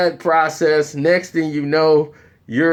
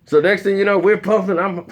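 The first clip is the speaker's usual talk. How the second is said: usual speaking voice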